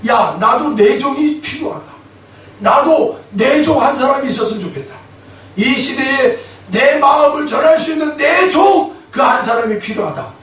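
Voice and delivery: a booming divine answer, pitched high; God's voice. Retold or imitated. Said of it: "하나님이 한나에게 뭐라고 그래요".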